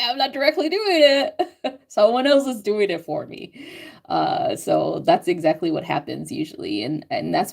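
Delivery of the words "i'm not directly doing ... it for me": innocent excuse. Retold or imitated. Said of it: "I'm like".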